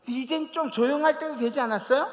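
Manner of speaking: irritated complaint. Retold or imitated. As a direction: nag the police officer angrily. Lady complaining to the police. (짜증 내며 항의)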